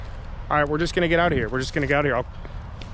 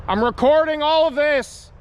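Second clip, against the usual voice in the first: calling out; 11 semitones higher, 4 dB louder, slower than usual. Real, not acted. Warning figures.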